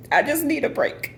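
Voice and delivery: real breaking voice, jumping very high; tearful, emotional. Real, not acted. Worn-out single mother confiding in viewers, voice breaking. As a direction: squeeze it out high through tears. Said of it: "I'm sorry for getting emotional".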